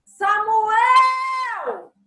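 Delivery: high, loud shout of the name; a mother calling her son from far away. Shown as a demonstration. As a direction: yell the name across the house. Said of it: "quando eu chamo meu filho".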